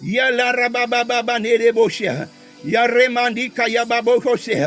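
usual speaking voice

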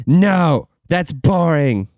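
put-on slow, dragged kid whine; whiny kid. Kid refusing mom again, slow whine.

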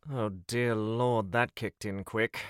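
ordinary talk

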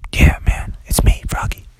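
whispered reassurance; hushed whisper. Acted. Rescue in the dark.

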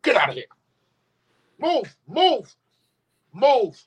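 high sharp commands, move move move; shooing the dog. Real, not acted. Owner shooing the dog away, high sharp commands. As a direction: shoo the dog off firmly and loud.

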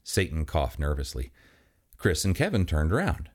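ordinary delivery

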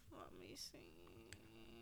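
whispered to herself, very slow; whispering. Real, not acted. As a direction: murmur it under your breath while thinking. Searching memory for a title.

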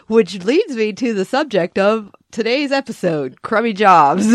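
mock announcer intro; playful podcast intro voice; quoting the podcast host. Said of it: "he was like".

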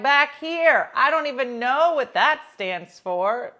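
loud, high, flustered; loud embarrassed kid explaining; loud flustered answer. Retold or imitated. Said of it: "You're like".

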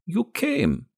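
put-on high gravelly old woman's voice, pleased; gravelly, high-pitched and pleased. A narrator's reading. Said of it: "sounding pleased".

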